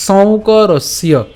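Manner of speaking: সৌকরস্য drawn out very slowly, syllable by syllable; slow model word. Shown as a demonstration. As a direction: stretch out every syllable of সৌকরস্য. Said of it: "এরপর". (খুব ধীরে সৌকরস্য)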